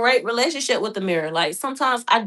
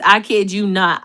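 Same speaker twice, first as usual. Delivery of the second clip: normal talking voice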